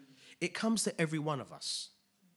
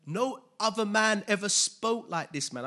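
louder and higher, proclaimed; proclaiming; 7 semitones higher, 9 dB louder than usual. Real, not acted. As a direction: declare it to the whole room. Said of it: "repeats the quote with force".

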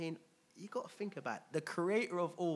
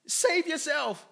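high, shouted jeer that climbs to the end; hammed-up mocking yell, slow and stretched; blaspheming taunt; 9 semitones higher, 12 dB louder than usual. Crucified criminal shouting at Jesus to save them, mocking. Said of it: "blasphemed him".

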